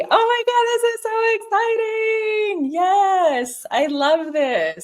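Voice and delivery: thrilled. Real, not acted. Realtor reading a viewer's note, real thrilled gush. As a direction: squeal it with real excitement. Thrilled reaction to a caller's message.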